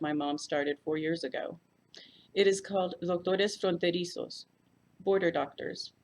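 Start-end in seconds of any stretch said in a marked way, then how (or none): none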